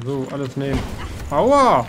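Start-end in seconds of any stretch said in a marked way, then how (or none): 1.3-1.8 sharp pained yelp; loud ouch. Real, not acted. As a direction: yelp from a sudden hit. Hit by an enemy.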